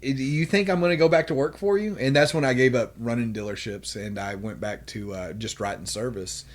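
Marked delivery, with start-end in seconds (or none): 0.0-2.0 raised, louder quoted challenge; defiant retort. Retold or imitated. Telling off the former boss, raised defiant voice. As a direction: throw it back at the old boss.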